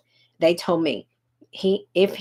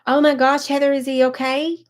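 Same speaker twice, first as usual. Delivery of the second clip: higher, louder sudden concern; alarmed surprise; 7 semitones higher, 4 dB louder than usual. Real, not acted. Host reacting to a follower's news, alarmed. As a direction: jolt up in real alarm for her.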